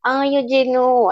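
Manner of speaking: mock a posh Mexico City girl; niña fresa accent. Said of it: "el típico de la niña fresa". (imitando a una niña fresa mexicana, tono agudo)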